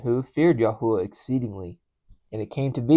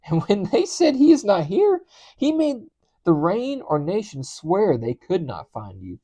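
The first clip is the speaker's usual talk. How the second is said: high, loud, agitated pleading; panicked protest; 5 semitones higher, 4 dB louder than usual. A narrator's reading. Servant protesting to Elijah that Ahab will kill him, panicked protest. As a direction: pitch climbing as the dread mounts.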